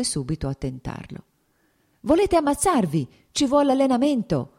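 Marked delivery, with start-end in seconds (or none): 2.0-4.5 called out high and louder across the ice; shouted warning. A narrator's reading. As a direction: yell a friendly warning at a reckless skater. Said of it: "gli gridò Nikolai Scerbački". (grido di avvertimento, più acuto e forte)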